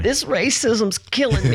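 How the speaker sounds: laughing. Real, not acted. Laughing exclamation.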